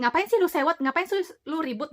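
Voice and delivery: imitating an annoyed team brushing her off; mock scoffing retort. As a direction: scoff as if she is fussing over nothing. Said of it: "mereka berpikir kayak".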